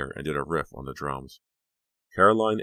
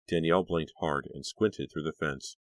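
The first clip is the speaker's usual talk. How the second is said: ordinary delivery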